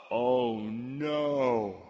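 low, drawn-out groan of realisation; sinking dread. Acted. Soldiers realising they are trapped, sinking dread. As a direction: let it sink in slowly.

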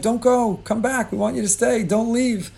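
high, pleading "don't go"; mock begging. Family begging the in-laws to stay, high and pleading. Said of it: "we begged".

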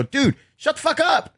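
high, exasperated retold outburst; fed-up comic silencing a heckler; retold snap. Comic snapping at a heckler, high and exasperated. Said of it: "I go".

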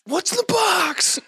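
high, slow, strained shout; shouted movie quote. Pretend. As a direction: scream it like the film's climax.

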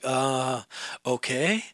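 low, soft, uncertain reply; drawn-out doubtful "uh", then wary okay; hesitant student. Retold or imitated. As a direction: imitate a reluctant agreement. Student unsure about offered song.

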